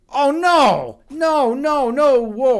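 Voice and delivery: high and loud, oh no no no whoa; real panic at a near blunder; startled. Real, not acted. Streamer sees his chess blunder, startled cry. Opponent nearly wins a pawn.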